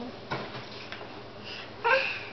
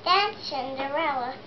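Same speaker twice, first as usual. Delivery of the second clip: ordinary talk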